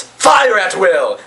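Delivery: high, drawn-out command to open fire; acted war cry; battle scream. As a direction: stretch it out like a commander ordering cannons. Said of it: "and screams".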